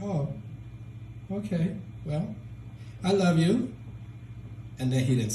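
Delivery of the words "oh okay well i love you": higher, hesitant, rising at the end; awkward tenderness. Retold or imitated. Son telling his emotionless dad he loves him, awkwardly tentative.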